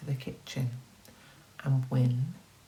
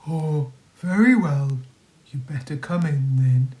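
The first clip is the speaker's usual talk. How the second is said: louder, resigned wolf; wolf giving in; 6 dB louder than usual. Acted. The wolf lets the neighbours in, louder. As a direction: give in with a sly weight.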